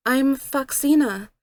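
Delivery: lightly voiced girl, a touch of teasing; playful jab at a new friend, lightly voiced; joking. A narrator's reading. Narrator teasing Senorita about her name, mild playful girl voice. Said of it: "I joked".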